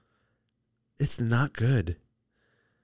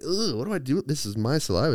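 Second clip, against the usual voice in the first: higher disgusted whine; grossed out; 6 semitones higher than usual. Retold or imitated. Hair stuck in mouth, whining in disgust. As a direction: recoil at the slimy fingers.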